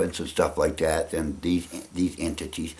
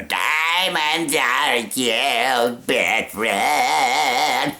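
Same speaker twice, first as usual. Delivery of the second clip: very slow, higher, warbling showbiz diva drawl; Carol Channing impression; 5 semitones higher, much slower than usual. Joking about impressions, he does a slow, high Carol Channing. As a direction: stretch every word like an old Broadway star. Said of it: "So maybe Carol Channing or".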